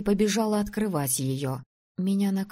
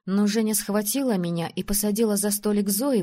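ordinary delivery, nothing marked

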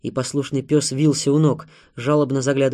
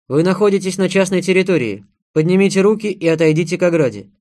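loud, higher-pitched official order; raised voice of an armed guard; commanding; 5 semitones higher, 8 dB louder than usual. A narrator's reading. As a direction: call out a warning to intruders. Armed man orders the intruders.

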